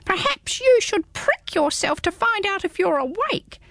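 high, small squeaky creature; a tiny curious hedgehog peering up; high little hedgehog, puzzled. Acted. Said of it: "suggested the hedgehog".

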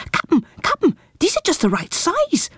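high eager pirate; little pirate voice. A narrator's reading. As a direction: squeak it like a tiny pirate. A small pirate speaks.

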